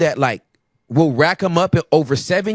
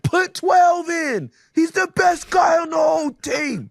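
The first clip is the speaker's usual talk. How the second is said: mock excited fan on the bench; 14 semitones higher than usual. Teammate on the bench shouting at the coach, high mock cheer. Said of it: "and you're like".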